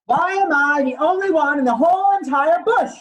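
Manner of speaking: a put-upon koala protesting his name in rhyme; mildly voiced cross little koala; indignant koala. Koala character lines in picture book.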